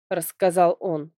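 usual speaking voice

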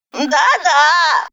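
put-on high, smug old woman's affirmation; self-satisfied granny. A narrator's reading. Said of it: "довольная произведённым эффектом". (довольная собой бабка)

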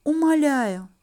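pleading, with put-on sighs; cornered and desperate. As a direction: beg breathily, a bit overplayed. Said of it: "прошептала я".

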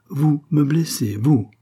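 a queen repeating the insult with scorn. A narrator's reading. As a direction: spell out her outrage slowly. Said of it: "s'écria-t-elle".

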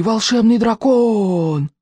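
slow, breathy awe; awed exhale. A narrator's reading. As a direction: marvel at it in awe. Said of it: "хором выдохнули они".

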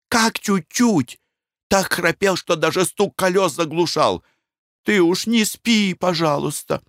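scolding wife. Acted. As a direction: nag him anxiously. Wife's scolding.

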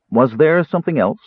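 ordinary delivery

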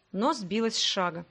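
ordinary delivery, nothing marked

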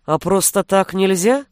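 ordinary delivery, nothing marked

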